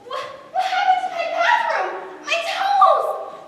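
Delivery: high, shocked outburst; horrified at the mess; shocked cry. Acted. Mother discovering her wrecked bathroom, high shocked cry.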